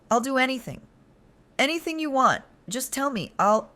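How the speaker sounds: high, loud desperate pleading; kneeling woman begging her boyfriend; frantic begging. Acted. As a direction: throw every word at him, begging.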